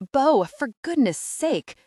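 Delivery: loud, high and slow bellow; furious boyfriend bellows at Bo from the restaurant. Acted. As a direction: bellow her name across the street. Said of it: "James bellows".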